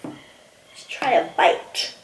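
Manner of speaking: slow hushed whisper. Real, not acted. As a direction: whisper it slowly before tasting. Whispers before first bite.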